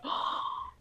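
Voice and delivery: mock gasp. Retold emotional gasp.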